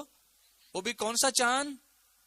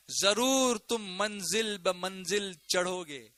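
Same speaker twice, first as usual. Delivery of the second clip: slow, measured declamation; solemn recitation; slower than usual. Real, not acted. Preacher reveals the translation to congregation, solemn and slow. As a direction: draw out each word with weight.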